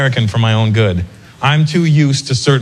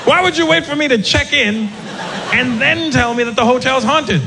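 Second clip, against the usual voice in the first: higher, squeaky mock alarm; mock panic; 10 semitones higher than usual. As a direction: play the scared guest, voice jumping up. Scared guest confronting the bellman.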